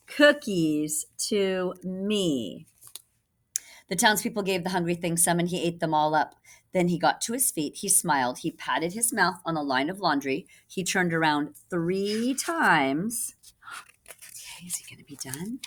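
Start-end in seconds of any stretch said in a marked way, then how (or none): 0.1-2.7 slow, drawn-out reveal; little boy's answer. Acted. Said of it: "said the little boy". 14.6-15.6 quick, much softer aside. Real, not acted. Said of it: "quiet fast aside".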